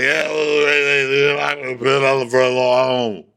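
slurred run of indistinct words; mock gibberish. Imitating poor Kyle's unintelligible talk, slurred mock gibberish. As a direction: smear the words into English-sounding babble. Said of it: "there's no distinct words coming out".

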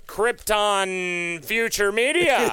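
slow, drawn-out grand company name; mock company jingle voice. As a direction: announce the brand with hammed-up slow grandeur. Mock corporate slogan for company.

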